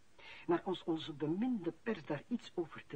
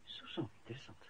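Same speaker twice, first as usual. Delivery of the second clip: whisper; 6 semitones lower, 10 dB quieter than usual. Acted. Boss reacts to the secret.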